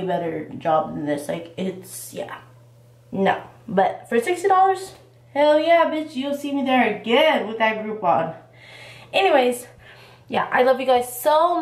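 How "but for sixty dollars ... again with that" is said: excited. Real, not acted. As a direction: hype it up, pitch jumping. Hyped about the sixty-dollar deal.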